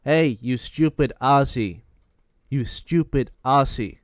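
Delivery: loud, slow taunt; loud, drawn-out jeer at the kid; mocking taunt. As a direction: jeer it out slowly and loudly. Retells American player's insult.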